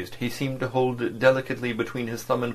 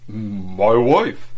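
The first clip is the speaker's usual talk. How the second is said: My wife said louder, husky; voice raised slightly; 7 dB louder than usual. A narrator's reading. Agent insisting to his boss, voice raised slightly. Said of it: "raised his husky voice slightly".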